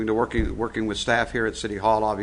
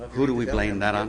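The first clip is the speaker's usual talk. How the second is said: ordinary talk, nothing marked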